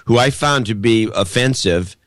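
ordinary talk

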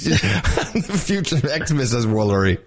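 giggling out the line mid-laugh; can't stop laughing while repeating it; laughing through words. Real, not acted. Cracking up as she repeats her friend's joke, laughing.

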